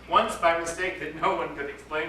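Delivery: ordinary delivery, nothing marked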